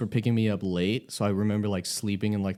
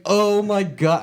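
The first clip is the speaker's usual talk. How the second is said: mock shock; 10 semitones higher, 7 dB louder, slower than usual. Retelling sudden embarrassed realization.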